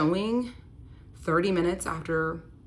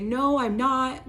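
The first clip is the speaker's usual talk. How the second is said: denial; 8 semitones higher, 6 dB louder, slower than usual. Retold or imitated. Boyfriend denies gambling, retold.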